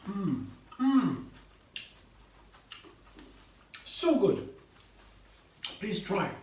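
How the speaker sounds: eating noisily with mouth full; messy loud eating. Real, not acted. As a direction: smack and hum through a big bite. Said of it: "my mouth is full".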